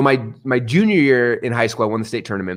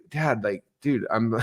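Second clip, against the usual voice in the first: a hint of a groggy, annoyed teen, softer; annoyed teenager; 7 dB quieter, slower than usual. Teen son groaning at Dad's early coaching, softly annoyed. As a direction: brush Dad off half-heartedly. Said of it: "you know, the annoyed teenager".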